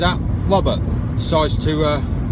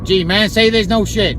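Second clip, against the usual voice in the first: put-on higher, louder American accent; 5 semitones higher, 3 dB louder than usual. Applicant spots a flaw in the plan, American accent. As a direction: protest in a hammed-up American accent. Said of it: "that's the part of the American accent".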